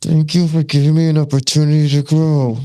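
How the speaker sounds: loud, high thanks through clenched anger; forced angry thanks. Retold or imitated. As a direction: spit the thanks out while furious.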